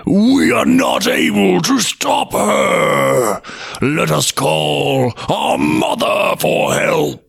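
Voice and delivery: slow, crying windy voice; desperate blizzards. Acted. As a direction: wail for help. Blizzards plead.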